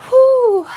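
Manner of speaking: high, loud whew; heavy exhale. Real, not acted. Streamer pulling herself together on a livestream, loud exhale. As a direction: blow out a big steadying breath. Collecting herself after crying.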